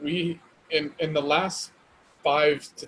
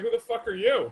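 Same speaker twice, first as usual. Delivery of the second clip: higher, baffled demand at the door; shocked buyer; 7 semitones higher than usual. Retold or imitated. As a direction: act stunned at a stranger. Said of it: "they're like".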